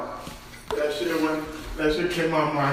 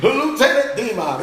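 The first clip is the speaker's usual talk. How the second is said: mock officer's call, pitched up; general calling his lieutenant; 9 semitones higher than usual. General summons his loyal lieutenant, mock commanding. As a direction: lightly play a general addressing his subordinate.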